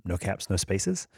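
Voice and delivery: normal talking voice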